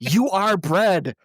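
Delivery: YOU ARE said as two slow separate words before "bread"; mock yell, uncontracted. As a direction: spread out "You are bread", contraction fully undone. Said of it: "the subtitles have it spelled out".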